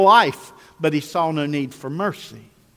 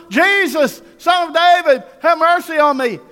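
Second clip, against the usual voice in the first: high, loud shouted plea; crying out louder; 11 semitones higher, 7 dB louder than usual. Acted. Blind beggar refusing to be silenced, crying out louder. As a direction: yell it high over the crowd. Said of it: "he cried out louder".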